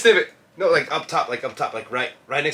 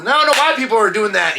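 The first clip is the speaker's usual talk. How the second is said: ordinary talk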